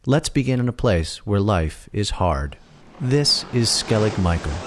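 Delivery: ordinary talk, nothing marked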